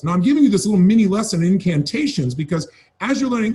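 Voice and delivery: ordinary talk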